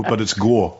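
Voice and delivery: "go" with a monophthong, Wisconsin style. Shown as a demonstration. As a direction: say "go" as a flat pure O. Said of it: "this inverted diphthong".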